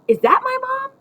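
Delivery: put-on high, loud child's call; yelling out. A narrator's reading. Boy calling to Grandma from the window, yelling out. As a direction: yell it out, pitch leaping up. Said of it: "I yelled out to Grandma".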